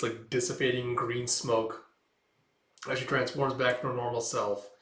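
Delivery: ordinary talk, nothing marked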